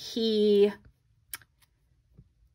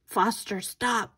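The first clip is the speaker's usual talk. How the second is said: ordinary talk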